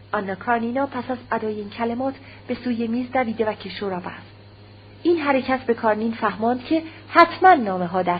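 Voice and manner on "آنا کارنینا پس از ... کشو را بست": ordinary delivery, nothing marked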